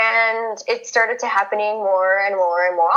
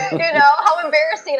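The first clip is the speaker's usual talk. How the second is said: laughing; 7 semitones higher than usual. Real, not acted. Laughing at own embarrassment.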